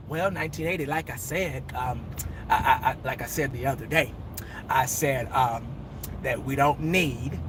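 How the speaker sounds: Jerry Jones deflecting questions; imitating Jerry Jones. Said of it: "He'd be like".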